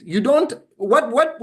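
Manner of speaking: normal talking voice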